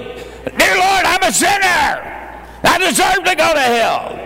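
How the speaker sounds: new convert barking his prayer of confession like a drill sergeant. Retold or imitated. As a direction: pray like you're giving orders on the parade ground. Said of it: "He'd say it like a drill sergeant".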